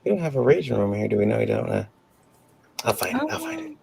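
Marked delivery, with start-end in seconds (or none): 3.3-3.8 high silly voice. Pretend. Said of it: "repeated in new voices".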